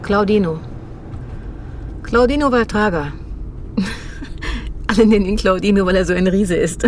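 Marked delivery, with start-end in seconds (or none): 3.2-4.8 breathy soft giggle. Acted. Laughs about giant friend.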